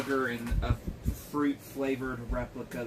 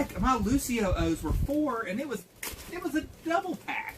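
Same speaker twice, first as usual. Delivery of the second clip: indignant; 11 semitones higher than usual. Real, not acted. Complaining about the price.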